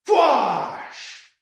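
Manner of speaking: drawn-out falling whoosh; blaster whoosh. Acted. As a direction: let the blast sound fade away. Blaster fire hits the manor.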